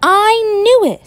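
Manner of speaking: high, delighted whoop. Acted. George delighted the Yeti eats spaghetti, whooping. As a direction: burst out with joy. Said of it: "with a whoop of delight".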